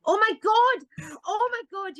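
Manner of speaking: imitating a fan's high, loud shriek of excitement; screaming fan. Glasgow fans chasing her down the street, high excited shriek. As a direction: squeal it like a starstruck fan spotting her. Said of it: "people run down the street after me going".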